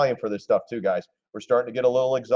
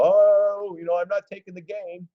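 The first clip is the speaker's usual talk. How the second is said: high, mocking voice of a greedy trader; 9 semitones higher than usual. Streamer mocks traders who won't take gains, high mock voice. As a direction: ham up the stubborn trader refusing to sell.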